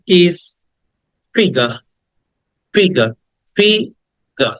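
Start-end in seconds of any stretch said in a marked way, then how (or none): 1.3-4.5 "figure" with a silent final r; British pronunciation. Shown as a demonstration. Teacher models "figure" for students, British pronunciation with silent r. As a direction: say "figure" British-style, ending on an open "ga". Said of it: "তাই আমি উচ্চারণ করছি না".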